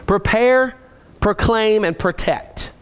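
spaced out word by word, with pauses; slow dictation for people writing notes; measured list, pausing between items; slowed for note-takers. Real, not acted. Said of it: "I'm slowing down for the note takers".

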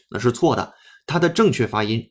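ordinary delivery

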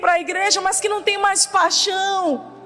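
impassioned declaration from the pulpit; rousing preacher, long driving phrases; fervent preaching. Real, not acted. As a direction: declare it with full conviction. Preaches to congregation.

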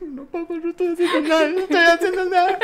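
high, whiny pleading with a laugh in it; pleading kid. Retold or imitated. Boy pleading with his furious father, whiny high plea. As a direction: beg like a scared little kid. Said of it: "Es como".

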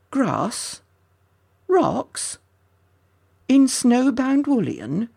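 high-pitched incredulous questioning; baffled, voice leaping up; astonished. A narrator's reading. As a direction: question each word in disbelief. Ginty astonished to find grass and rocks.

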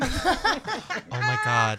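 drawn-out, high, laughing oh my God; laughing disbelief. Real, not acted. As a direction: dissolve into laughter. Cracking up at story.

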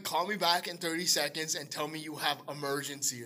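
measured, deliberate instruction; patiently insistent retold request; spelled-out repeat. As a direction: spell it out for someone who didn't get it. Repeats request after her baffled What.

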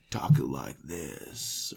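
low, slow character voice; Lorath. Acted. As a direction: drop it deep and drag it out. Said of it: "I sounded like freaking Lorath".

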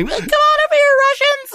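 imitated girl calling out in falsetto; mock excited girl. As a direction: summon them like a squealing party girl. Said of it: "this girl's like".

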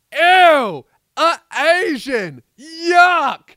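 loud, high yell of disgust, drawn out. Retold or imitated. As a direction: yell it in mock revulsion. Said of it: "yelling in a poor guy's face".

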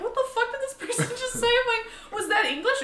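high fast disbelieving quote; straining to understand Irish speech; retold bewilderment. Confused by Irish accent.

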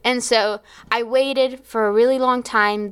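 ordinary talk, nothing marked

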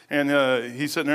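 ordinary talk, nothing marked

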